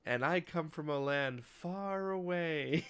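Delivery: sing-song like the meme character; mock Mister Schnrub. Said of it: "he's like".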